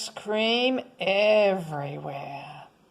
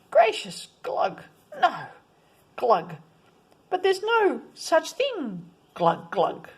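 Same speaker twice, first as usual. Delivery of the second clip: burbling fish; 6 semitones higher than usual. Acted. Fish speaking in the water.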